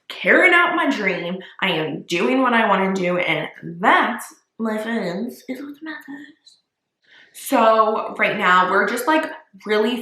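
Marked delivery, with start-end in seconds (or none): 3.8-6.6 dramatic whisper. Real, not acted. Dramatic closing line to viewers.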